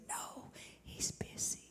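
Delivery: put-on slow, hushed child whisper. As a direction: whisper it slowly, like a secret. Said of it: "He whispered".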